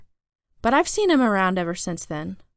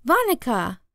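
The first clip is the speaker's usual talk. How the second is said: put-on higher-pitched toddler calling the babysitter; little brother Jamie; 4 semitones higher than usual. A narrator's reading. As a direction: call out lightly as a small child. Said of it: "said Jamie".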